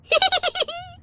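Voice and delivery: very high, loud burst of laughter; storyteller's gleeful laugh; high squeal of laughter. A narrator's reading. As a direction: laugh out in delight. Laugh after frog.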